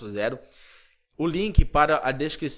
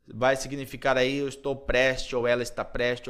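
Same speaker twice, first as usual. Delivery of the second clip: ordinary talk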